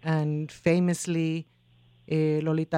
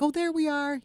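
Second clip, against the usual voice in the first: delighted exclamation; 11 semitones higher than usual. Retold or imitated. Quoting proud compatriots.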